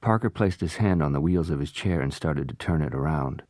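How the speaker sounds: usual speaking voice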